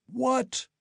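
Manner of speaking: high startled cry; shocked. A narrator's reading. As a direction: nearly fall off in shock. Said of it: "in his surprise".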